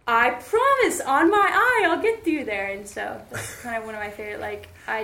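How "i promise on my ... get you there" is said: very high, loud earnest promise; mock earnest driver. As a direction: ham up the driver's vow.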